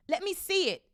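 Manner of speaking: high, loud whiny demand; bratty child insisting. A narrator's reading. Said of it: "demanded Dudley".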